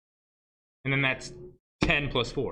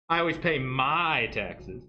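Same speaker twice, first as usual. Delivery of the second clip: slow, with "my" stretched and set apart; stress on MY; slower than usual. Shown as a demonstration. Teacher showing emphasis changes meaning, stress on "my". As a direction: lean hard on the word "my".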